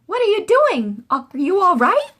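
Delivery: worried call. A narrator's reading. Boy calling worried into pool.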